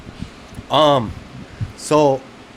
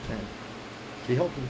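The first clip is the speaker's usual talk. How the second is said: usual speaking voice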